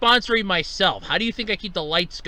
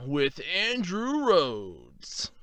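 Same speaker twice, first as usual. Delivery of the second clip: deep, slow, drawn-out announcer; 4 semitones lower, 4 dB quieter, much slower than usual. Acted. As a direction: stretch out the name like a show host. Spoken podcast title intro.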